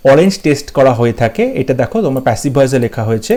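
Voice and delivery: usual speaking voice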